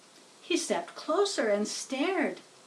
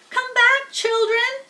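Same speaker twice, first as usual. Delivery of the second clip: much higher, louder desperate call; crying out; 13 semitones higher, 7 dB louder than usual. Acted. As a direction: call the vanishing babies back, frantic. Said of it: "he cried".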